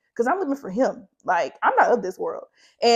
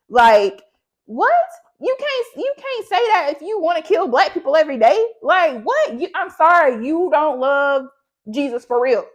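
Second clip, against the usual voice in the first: higher-pitched, exasperated outburst; indignant rant; 5 semitones higher, 4 dB louder than usual. Real, not acted. Vlogger calling out hypocritical Christians, indignant. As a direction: preach it with real indignation.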